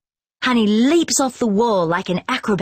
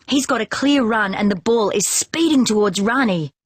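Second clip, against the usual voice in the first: ordinary delivery, nothing marked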